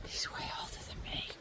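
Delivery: secret whisper so the runner won't hear; conspiratorial whisper behind his back. Real, not acted. Whispered remark about passing runner.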